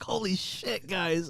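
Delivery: high excited exclaim; all caps excitement. Retold or imitated. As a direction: burst out with the news. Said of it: "all caps".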